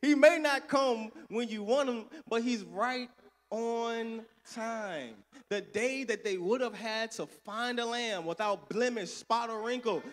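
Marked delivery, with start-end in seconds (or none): 0.0-5.1 louder, higher church saying; rousing old saying; proclaimed saying. Retold or imitated. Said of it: "the mothers in my church used to have a saying".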